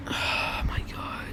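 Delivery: slow, breathy whisper; whispered disbelief. Retold or imitated. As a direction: whisper it slowly, as if stunned. Retelling own disbelief while crying there.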